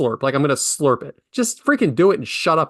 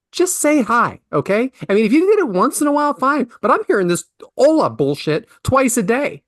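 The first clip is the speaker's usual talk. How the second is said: high-pitched, indignant rant; exasperated; 8 semitones higher than usual. Acted. Uncle fed up with nephew's greeting, exasperated. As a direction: complain in exasperation with pitch leaping up.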